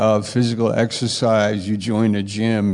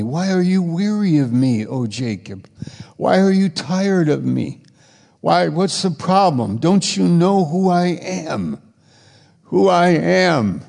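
higher, pleading voice of God asking why; God gently reproaching his people; 7 semitones higher than usual. Acted.

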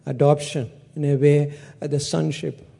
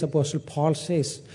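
ordinary talk

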